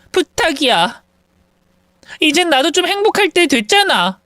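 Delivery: desperate pleading rival. Acted. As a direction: plead on the edge of tears, at the end of her rope.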